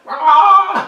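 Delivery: loud, high burst of "Ah"; sudden piercing yell; imitated noise. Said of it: "the sound's like".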